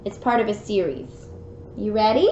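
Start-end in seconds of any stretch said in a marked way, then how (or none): none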